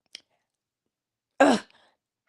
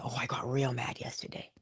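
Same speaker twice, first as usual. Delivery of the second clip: low, much softer, almost under the breath; low grumbling confession; 10 semitones lower, 11 dB quieter than usual. Shown as a demonstration. Preacher confessing her anger to the congregation, low and soft. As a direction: mutter it low like a simmering grudge. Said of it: "I got mad yesterday".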